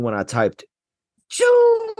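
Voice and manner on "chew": "Chew" as a high shouted Cajun exclamation. Shown as a demonstration. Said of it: "I was saying it like".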